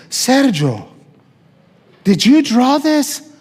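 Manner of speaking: a teacher stopping class in wonder; amazed teacher. Retold or imitated. Teacher discovering the boy's drawing, asking in high astonishment.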